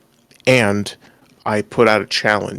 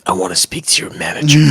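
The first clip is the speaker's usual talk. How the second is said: gravelly, slightly lower growl; low gravel vigilante growl; 3 semitones lower, faster than usual. Retold or imitated. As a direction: growl it like a gruff warrior. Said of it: "in his gravely voice say".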